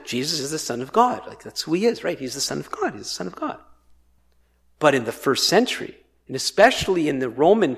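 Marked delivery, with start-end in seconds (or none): 0.1-3.6 quick, soft, offhand recital; taken for granted. Acted. Christians repeating a familiar title, quick and offhand. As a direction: toss it off as old news. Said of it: "we almost take it for granted".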